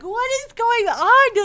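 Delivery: high, slow, loud what-is-going-on; mock horrified disbelief; stunned disbelief. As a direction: let the shock ring out, drawn out. Shocked reaction retold.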